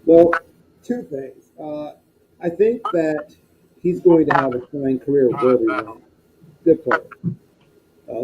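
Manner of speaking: muffled, like a bad mic. Real, not acted. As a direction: sound muffled and faint, far from the mic. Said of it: "you still sound a little muffled".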